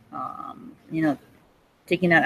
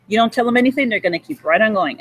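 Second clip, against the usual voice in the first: normal talking voice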